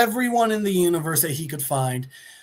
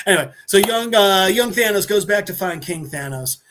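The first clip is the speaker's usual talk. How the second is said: normal talking voice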